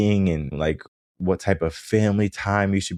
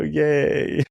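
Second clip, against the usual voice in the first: mock cheer; 9 semitones higher than usual. Dad cheering son's help.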